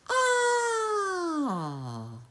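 long high wail sliding down; drawn-out, descending "aaah"; falling scream. A narrator's reading. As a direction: cry out as if falling. Character falls down in story.

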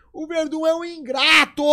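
high, loud, slow mock complaint; mock indignant outburst. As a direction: ham up an offended rant about an ungrateful friend. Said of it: "eu vou lá e começo a botar em". (desabafo indignado e exagerado, alto e agudo)

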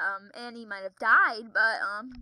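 normal talking voice

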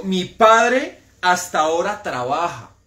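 a touch of a solemn Jesus; voicing Jesus. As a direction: proclaim it slowly like Jesus speaking. Preacher quotes Jesus slowly, loud.

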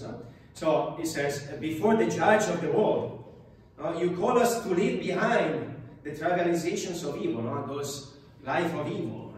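normal talking voice

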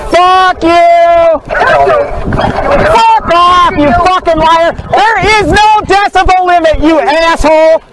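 angry yelling swear words; furious, spit the insults out; swearing tirade. Real, not acted. Yelling at the officer over the ticket.

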